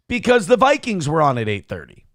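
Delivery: normal talking voice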